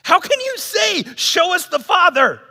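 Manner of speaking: high, loud, pleading question; exasperated plea. Retold or imitated. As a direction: cry out in disbelief at a friend. Jesus pleading with Philip, retold.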